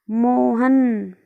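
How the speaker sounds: very slow Mohan with the मो drawn out; long calling. Shown as a demonstration. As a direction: stretch Mohan as if calling someone far off. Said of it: "लंबे time तक किसी को आवाज़ लगाते हैं". (मोहन के मो को खींचकर धीमी पुकार)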